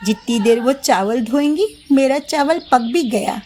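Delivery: ordinary delivery, nothing marked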